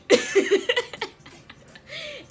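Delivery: loud, high fan squeal; demonstrated scream. Shown as a demonstration. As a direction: re-enact a starstruck scream. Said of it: "but I was screaming".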